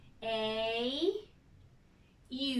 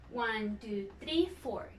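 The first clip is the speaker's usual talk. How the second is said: counting claps; 3 semitones lower, 8 dB quieter than usual. Shown as a demonstration. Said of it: "I counted four".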